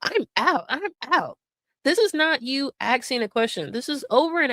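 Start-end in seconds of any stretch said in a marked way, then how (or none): 0.0-1.4 imitating her, pitched up and irritated; a hammed-up fed-up brush-off, higher than her own voice; mocking her angry outburst; mock angry snap. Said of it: "She's talking through her teeth. She's getting mad".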